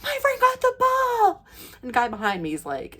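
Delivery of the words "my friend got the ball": very high excited mock yell; imitated yelling. Imitating friend telling everyone about the ball, high excited yell. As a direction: announce it to the crowd, squealing. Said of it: "she yelled out".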